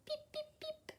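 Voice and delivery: put-on very high, soft baby-chick beeps; tiny chick peeps. A narrator's reading. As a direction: peep like a chick still in the egg. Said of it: "it said".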